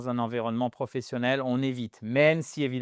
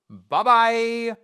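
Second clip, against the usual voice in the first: cheerful goodbye; 8 semitones higher, 7 dB louder than usual. Real, not acted.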